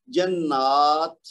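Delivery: slow "جنات", long naa ending in breathy t; stop on open ta. Shown as a demonstration. Teacher shows stopping on open ta, t kept. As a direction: stretch "جنات" and release the t, never h. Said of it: "اس کی آواز ہا میں تبدیل نہیں ہوگی".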